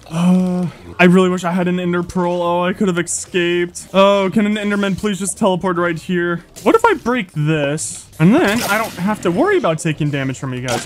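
ordinary delivery

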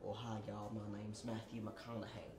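low, soft Texan McConaughey voice; lazy low Texan hello; Texan drawl. Acted. Doing the Texan character, low soft Texan drawl. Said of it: "the Texan character".

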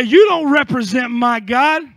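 defiant declaration. Acted. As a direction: tell your failure off. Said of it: "look at that failure and say".

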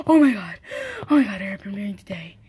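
whisper. Pretend. Excited whisper about concert news.

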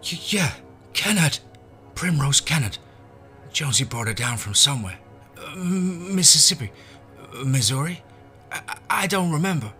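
high, loud panicky voice; stammering. Acted. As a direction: blurt it out in fear. Terrified boy stammers answers.

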